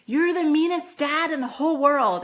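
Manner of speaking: high, loud mock teen outburst; outraged teenager. Teen whose phone was taken, outraged outburst.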